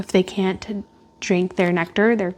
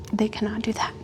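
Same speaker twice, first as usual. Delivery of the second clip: a soft whispered aside, much quieter; 7 dB quieter, faster than usual. Real, not acted. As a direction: whisper it as a sad little fact. Explaining birds hitting windows.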